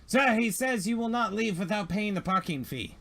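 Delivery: high, prim robot servant voice; nervous droid relaying a demand. Acted. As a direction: speak like a fussy droid. GM voicing a droid.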